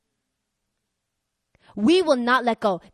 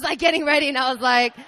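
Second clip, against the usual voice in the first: ordinary delivery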